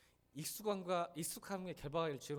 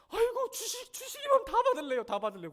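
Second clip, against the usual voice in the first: much higher, louder squeal of a wife; mock wife's voice; 12 dB louder than usual. Pastor mimics his wife wanting only blessings, high squeal. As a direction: play the wife gleefully grabbing blessings. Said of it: "그러시더라고요".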